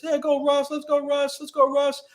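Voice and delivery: high, excited chant of a fan; imitating a cheering fan. Fan cheering Russ on his way to the locker room, high excited chant. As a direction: cheer him on like a fan in the stands.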